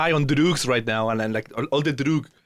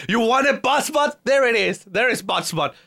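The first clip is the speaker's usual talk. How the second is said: mock exasperation; 7 semitones higher than usual. Mock exasperated answer to viewer.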